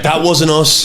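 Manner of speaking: hammed-up "That wasn't us"; mock denial. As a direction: protest innocence like a caught kid. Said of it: "now you're trying to be like".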